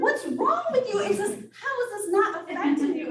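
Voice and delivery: high, loud mock outrage; indignant friends. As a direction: imitate tearful friends' shocked protest, half laughing. Friends' indignant reply, retold.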